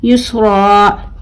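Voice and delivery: very slow "युसरा" with the last aa held long; drawn-out madd ending. Shown as a demonstration. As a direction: hold the open ending of "युसरा", no n. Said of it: "किस तरीके से?". ("युसरा" को खींच कर, बिना नून पढ़ना)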